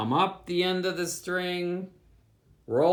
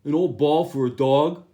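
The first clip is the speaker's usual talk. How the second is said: put-on the boy's voice; 6 dB louder than usual. A narrator's reading. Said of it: "the boy said".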